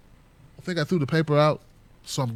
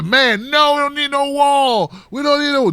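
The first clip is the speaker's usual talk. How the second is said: mocking protester voice; 8 semitones higher, 3 dB louder than usual.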